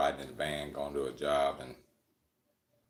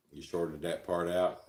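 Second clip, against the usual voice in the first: ordinary delivery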